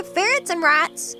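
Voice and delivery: ordinary talk, nothing marked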